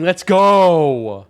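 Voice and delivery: loud triumphant whoop; excited cheer. Real, not acted. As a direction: celebrate your own acting out loud. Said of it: "my voice acting".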